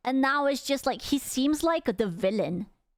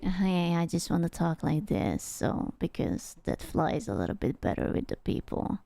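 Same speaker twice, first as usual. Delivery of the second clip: imitated deep-voiced streamer; 7 semitones lower than usual. Reactor imagines the streamer explaining his voice, put-on low. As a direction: drop it right down, low and hushed. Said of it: "he just, like".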